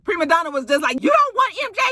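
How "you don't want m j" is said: very high, heated mock rant; high-pitched, hammed-up quarrel voice; mimicked accusing tirade. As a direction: imitate a diva firing off accusations at the top of her range. Mimics Prima Donna's accusing tirade.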